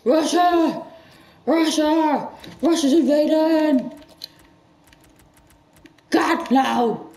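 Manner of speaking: high, loud panicked yelling; hammed-up panic shout; mock alarm. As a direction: sound the alarm like the sky is falling. Mock panic at Russia invading.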